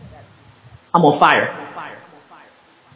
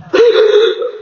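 sharp, play-acted gasp of shock; mock shocked gasp; 14 semitones higher than usual. Shown as a demonstration. Playing the game of shock on cue, mock shocked gasp. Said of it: "play the game of shock".

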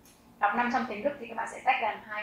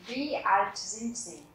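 slow model question; much slower than usual. Shown as a demonstration. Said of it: "teacher models question".

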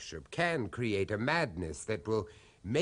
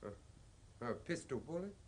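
much softer, halting and uneasy; nervous hush; 11 dB quieter than usual. Acted. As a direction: creep up on the words, stammering. Nervously approaching the cabinet.

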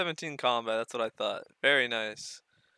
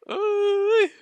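excited whoop; 9 dB louder than usual. Real, not acted. Celebrating the drop.

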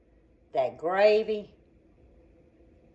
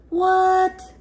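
sudden high startled what; surprised squeak; 12 semitones higher than usual. Real, not acted. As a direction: caught off guard, pitch jumps high. Sudden reaction while cooking.

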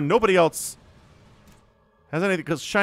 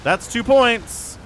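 higher, slow, drawn-out call; excited emphasis; 7 semitones higher, 3 dB louder, slower than usual. Real, not acted. Caster hyping Canuck's second score, excited emphasis. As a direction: stretch out points in a raised voice.

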